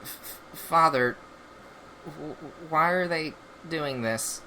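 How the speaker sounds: soft, halting stutter on the first sounds; mildly uneasy stammer. Acted. Son turning to his father about the robots, mildly uneasy stammer. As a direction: stammer quietly, a touch of unease. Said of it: "He looks a little uneased".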